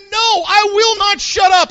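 loud, high defiant refusal; Bartimaeus. Retold or imitated. Blind beggar refusing to be silenced, defiant shout. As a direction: refuse at full voice. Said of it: "Blind Bartimaeus, he said".

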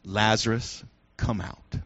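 very low, quiet, solemn call; hushed command. Acted. Jesus at the tomb calling Lazarus, hushed and solemn. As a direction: drop low and soft for a grave summons.

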